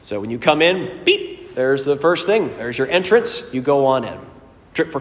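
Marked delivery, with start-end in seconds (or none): 1.0-1.5 high chirpy beep like a wristband scanner; imitated scanner beep. Preacher imitating the park scanner, high beep. Scanner sound in Disney story.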